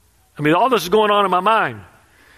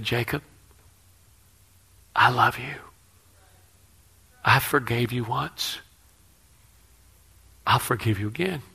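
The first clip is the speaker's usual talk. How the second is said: loving voice of God; 4 semitones lower, 6 dB quieter than usual. Retold or imitated. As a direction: gently reassure him he is forgiven. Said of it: "I heard another voice".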